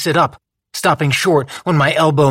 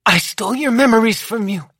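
Lou, higher; 8 semitones higher than usual. A narrator's reading. Lou confesses.

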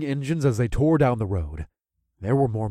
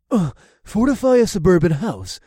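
scoffing; 7 semitones higher, 5 dB louder than usual. A narrator's reading. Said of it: "Carl scoffed".

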